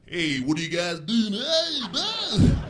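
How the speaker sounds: put-on confused mix of Alabama and Brooklyn accents; hammed-up Alabama or Brooklyn greeting. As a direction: waver between Alabama twang and Brooklyn, climbing high. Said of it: "doesn't know if he's from Alabama or Brooklyn".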